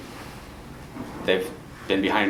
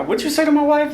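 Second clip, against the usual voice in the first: much higher, louder playful challenge; hammed-up indignant husband, voice shooting up; mock confrontation; 5 dB louder than usual. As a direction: act out squaring up for his wife. Acted confrontation with an actor.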